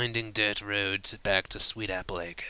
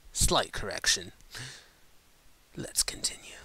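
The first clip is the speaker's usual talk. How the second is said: whispered aside. Real, not acted. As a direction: breathe it out as an aside. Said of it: "aside to listeners".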